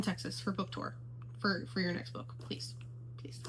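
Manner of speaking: soft whispered begging; pleading whisper. Real, not acted. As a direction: whisper the plea to the author. Begging author for book tour.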